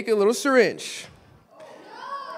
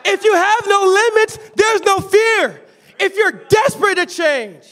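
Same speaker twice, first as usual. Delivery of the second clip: higher, louder fiery sermon; preaching at full force; 10 semitones higher, 7 dB louder than usual. Acted. Preacher challenging the congregation to change, fiery preaching. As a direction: thunder the challenge at the church.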